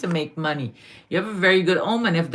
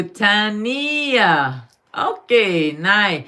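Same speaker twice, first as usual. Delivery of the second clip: usual speaking voice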